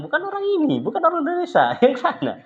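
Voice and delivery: very high, sharp correction with a laugh at the end; Syekh's correction. Retold or imitated. The Syekh tells the guards they have the wrong students, high and amused. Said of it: "Syekh bilang".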